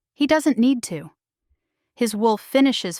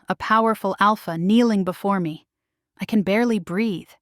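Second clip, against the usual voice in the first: plain reading voice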